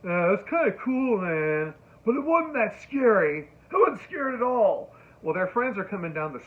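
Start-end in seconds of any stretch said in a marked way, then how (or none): 0.0-4.9 a lightly voiced teenage boy, much higher and louder; lazy teen bravado, higher pitched; teenage dude. Teen boy shrugging off the scary movie, mildly mock-cool. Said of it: "we overhear them say".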